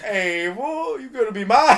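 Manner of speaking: high, mock possessive catcall; hammed-up claim, building loud; leering prisoner. Prisoner claiming the new man, hammed-up and high. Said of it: "The prisoner's like".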